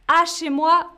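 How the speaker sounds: À chez moi flagged as wrong; wrong form again. Shown as a demonstration. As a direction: punch À, À chez moi, as the error.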